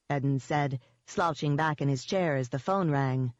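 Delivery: plain reading voice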